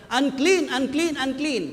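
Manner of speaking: high, loud cry of unclean; lepers' warning shout. Retold or imitated. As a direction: call out the warning from afar. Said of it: "sumisigaw sila".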